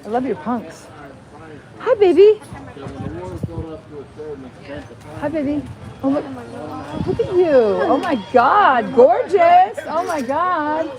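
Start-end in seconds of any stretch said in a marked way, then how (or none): 1.8-10.9 put-on talking to a baby. Cooing over someone's baby.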